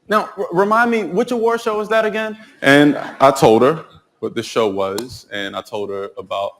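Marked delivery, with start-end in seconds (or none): 0.1-2.4 imitated friend, high-pitched and curious; put-on high voice of a puzzled friend; inquisitive homegirl. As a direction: play the friend checking a detail, pitched up high. Voices homegirl asking about show.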